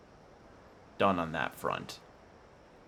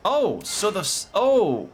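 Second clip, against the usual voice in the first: excited; 13 semitones higher, 6 dB louder, slower than usual. Real, not acted. Sudden realization while playing.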